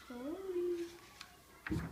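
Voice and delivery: plain reading voice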